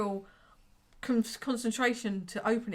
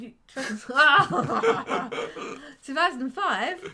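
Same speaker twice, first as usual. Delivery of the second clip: laughing disbelief; 5 semitones higher, 8 dB louder, slower than usual. Real, not acted. Laughing at the wrong year.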